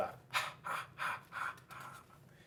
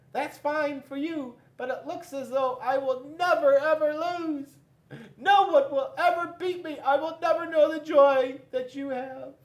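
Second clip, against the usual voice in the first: much higher, louder sad-boastful paper; squeaky Paper voice; 14 semitones higher, 9 dB louder than usual. Acted. Paper talks to defeated Rock, high whiny voice. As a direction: whine about never losing. Paper laments never losing.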